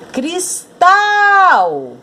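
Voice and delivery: "Cristal" called out high, long swooping "-tal"; calling the word. Shown as a demonstration. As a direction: call out "Cristal", stretching the last syllable. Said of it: "mas eu chamo". (chamando "Cristal", com "-tal" agudo e alongado)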